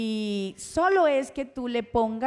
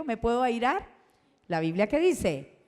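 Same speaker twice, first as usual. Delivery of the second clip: usual speaking voice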